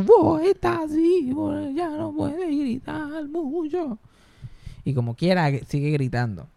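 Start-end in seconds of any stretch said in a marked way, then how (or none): 0.0-4.0 high, slow grandma voice that can't yell anymore; worn-out shouter's voice. Acted. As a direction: imitate grandma with a voice spent from yelling. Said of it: "la que ella tiene la voz así". (abuela con voz gastada de gritar, aguda y lenta)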